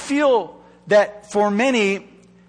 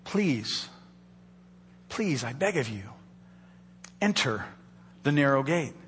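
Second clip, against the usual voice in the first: hushed, earnest plea, much softer; soft pleading; 6 semitones lower, 9 dB quieter than usual. Acted. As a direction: beg them quietly, almost under the breath. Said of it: "I can hear Jesus pleading".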